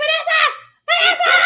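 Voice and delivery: high, loud panicked cry for help; hammed-up, laughing panic shout; mock panic. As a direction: imitate a frantic mother yelling for her daughter. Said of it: "She was all panicking".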